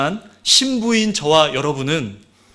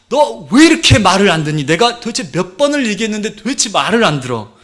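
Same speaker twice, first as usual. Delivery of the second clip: exasperated scolding, louder, slowing on the last plea; strong warning; 4 semitones higher, 5 dB louder than usual. Acted. God scolding people who won't listen, exasperated and loud. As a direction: scold them like a fed-up parent who keeps repeating himself. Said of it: "이렇게 하나님께서 우리에게 강력하게 말씀하시고".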